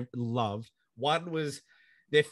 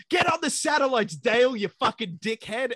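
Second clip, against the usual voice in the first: higher, louder mock shout of an order; mock angry order; 9 semitones higher, 4 dB louder than usual. The boss shouting at Dale to get on the satellites, higher and louder. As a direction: bark it at Dale like a furious boss. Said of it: "that lady who takes over control from Amanda Waller is like".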